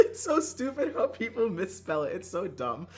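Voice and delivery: high, loud, laughing through the words; laughing exasperation. Real, not acted. Streamer ranting about misspelled name, laughing in exasperation. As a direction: complain while cracking up.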